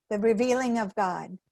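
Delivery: ordinary delivery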